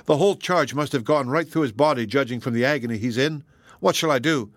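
ordinary delivery, nothing marked

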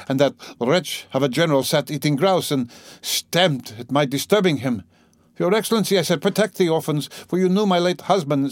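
proud gentlewoman petitioning. Acted. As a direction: play a distressed lady keeping up her dignity.

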